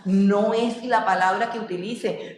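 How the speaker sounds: ordinary delivery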